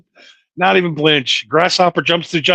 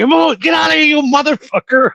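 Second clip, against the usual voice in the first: high, panicked mock scream; 10 semitones higher than usual. Driver retelling his grasshopper panic at the drive-thru, high screaming. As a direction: re-enact screaming at an intruder, pitched way up. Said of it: "I'm screaming".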